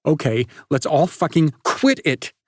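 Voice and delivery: loud high bark; barking at the boys to stop. Acted. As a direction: bark the order. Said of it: "Chloe barked".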